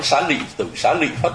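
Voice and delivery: Xá Lị Phất with "lị", not "lợi". Shown as a demonstration. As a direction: say Lị with an i vowel in Xá Lị Tử. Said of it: "đúng là cái tên của ông là Xá Lị Tử". (đọc Xá Lị Phất với âm "lị")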